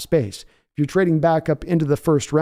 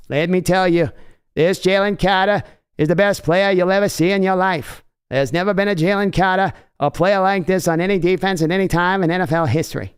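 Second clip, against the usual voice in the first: lightly put-on Boston accent drifting into a Kennedy voice; a fair Boston accent that sounds like Kennedy; hammed-up Boston accent, a bit higher; 4 semitones higher than usual. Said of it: "I can do a Boston, Mark, but it, it all becomes Kennedy".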